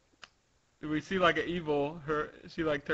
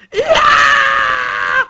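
long, piercingly high loud scream; waking scream; 16 dB louder than usual. Acted. Sugar awakes terrified, long high scream. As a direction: shriek at full volume.

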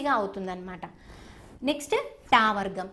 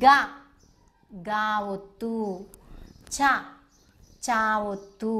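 slow drill recital; 3 semitones lower, slower than usual. Shown as a demonstration. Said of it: "నాతో పాటు మీరు కూడా చెప్పండి".

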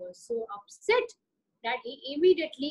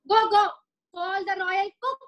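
loud high royal command; irritated Raja; 4 semitones higher, 4 dB louder than usual. Acted. Angry Raja sends for the royal cook, loud and commanding. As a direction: bark orders like an annoyed king.

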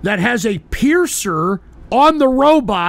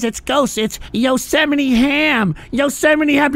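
ordinary talk, nothing marked

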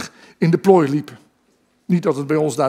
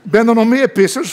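higher and louder, laughing through it; cheeky laughing question; 6 semitones higher, 5 dB louder than usual. Retold or imitated. Son teasing his giggling mother, laughing cheekily. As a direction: toss it out grinning, half laughing. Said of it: "vroeg ik".

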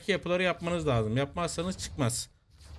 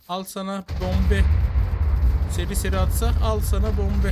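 loud, raised attacking shout; excited combat yell; battle taunt; 4 semitones higher, 9 dB louder than usual. Real, not acted. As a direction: fling it out loudly with each shot. Shouts while firing at creature.

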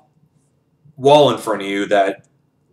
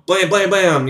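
raised-pitch mock gunshots, blam blam; imitated gunfire; 7 semitones higher than usual. Retelling Felix's shooting, mock gunfire noises. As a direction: pop the blams like rapid rifle fire.

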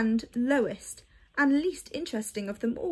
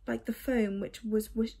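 ordinary delivery, nothing marked

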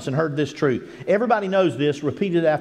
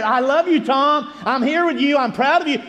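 joyful; 6 semitones higher than usual. Retold or imitated. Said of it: "I say".

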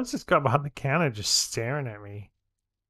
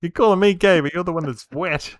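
loud, higher mock-indignant retort; 7 semitones higher, 9 dB louder than usual. Real, not acted. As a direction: fire it back loudly. Banter argument over being wet.

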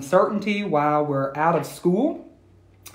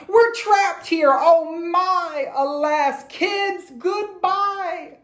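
higher, hammed-up shriek of panic; panicked cry; 12 semitones higher, slower than usual. Teacher trapped on the island with her class, hammed-up higher panicked cry. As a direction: wail it like a stranded teacher. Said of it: "Miss Lila Greer started to scream".

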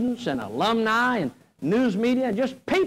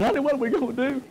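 mock whimper; 3 semitones higher, faster than usual. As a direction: play it as a panicky husband begging his wife. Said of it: "I started crying".

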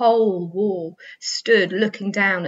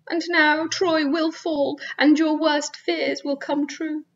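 a widow's raised, tearful lament; grieving widow; 8 semitones higher than usual. A narrator's reading. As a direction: mourn him aloud, pitched high. Andromache's lament continues.

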